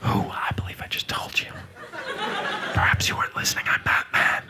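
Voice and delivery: whispered Batman growl; husky hero whisper. Pretend. Mirror Batman dialogue.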